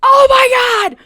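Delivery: high, loud imitation of brother's outburst; sudden full-volume excited cry; excited shout. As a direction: yell it like you've just spotted something amazing. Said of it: "and he just goes".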